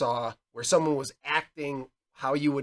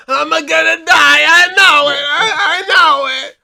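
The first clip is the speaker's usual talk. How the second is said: much higher, much louder crying scream, slow on the end; dying man scream; 14 semitones higher, 16 dB louder, slower than usual. Retold or imitated. Fan acting the shot robber's panic, crying scream. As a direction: wail it like you're bleeding out. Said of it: "and then went".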